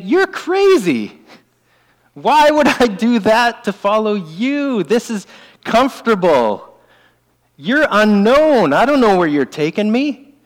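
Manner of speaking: high, slow, disbelieving "You're crazy"; incredulous skeptic. Retold or imitated. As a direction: play the baffled doubter who won't budge. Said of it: "We'd think".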